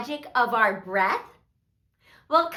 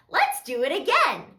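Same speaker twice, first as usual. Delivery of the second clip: plain reading voice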